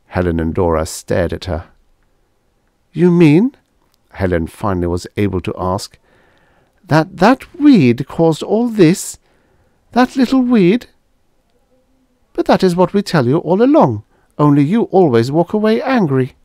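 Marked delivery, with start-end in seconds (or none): 2.9-3.6 stunned. Acted. Helen stunned by news. 6.8-10.9 high, slow, incredulous; can't believe it was the weed; disbelief. Acted. Helen disbelieving the little weed. 12.3-16.3 high, loud, plainly reproachful alien; a higher voice with a childlike complaint; Venusian girl. Acted. Venusian girl telling Helen she always walked away.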